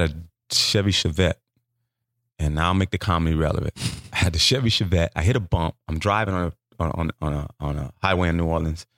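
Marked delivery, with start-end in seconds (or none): none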